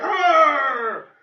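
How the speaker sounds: loud, high, growling rage; hammed-up furious king wanting to punish; angry growl. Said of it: "He's like".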